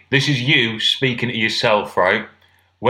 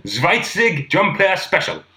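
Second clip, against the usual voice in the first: slow, higher mock German; bad German accent; 4 semitones higher, slower than usual. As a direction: spell out the order in comic German. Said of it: "I can't do accents".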